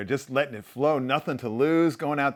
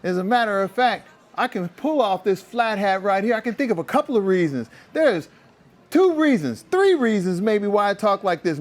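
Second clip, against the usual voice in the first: high, put-on American street accent; imitating a player's fake American accent; mock American accent; 8 semitones higher, 4 dB louder than usual. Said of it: "I think it goes a little something like this".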